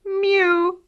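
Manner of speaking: high kitten mew at full volume; loud mew. A narrator's reading. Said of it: "as loud as he could".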